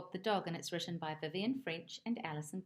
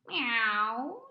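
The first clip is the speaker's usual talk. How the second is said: meow; 4 semitones higher, 7 dB louder than usual. Acted. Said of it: "she said".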